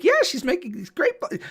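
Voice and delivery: high, lively wife voice; imitating wife. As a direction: chirp it brightly as Wendy. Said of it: "And Wendy's like".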